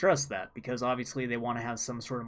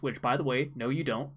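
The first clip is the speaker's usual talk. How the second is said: ordinary delivery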